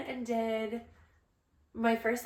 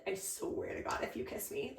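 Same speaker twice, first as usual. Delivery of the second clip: low warning; 2 semitones lower, 10 dB quieter than usual. Retold or imitated. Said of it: "I looked at my mom and I said".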